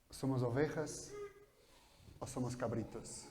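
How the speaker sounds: ordinary delivery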